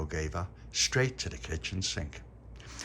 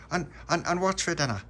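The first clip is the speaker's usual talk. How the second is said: put-on high child voice; 9 semitones higher, 3 dB louder than usual. A narrator's reading. Child asks mum in story.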